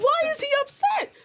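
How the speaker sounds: very high, squeaky mock disbelief; retold bewilderment. Retells own baffled reaction, very high.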